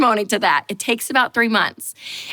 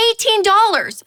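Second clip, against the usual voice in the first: much higher and louder, incredulous; outraged disbelief; 11 semitones higher, 4 dB louder than usual. Retold or imitated. Repeating the lemonade price, high, loud and outraged. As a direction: nearly yelp the price back.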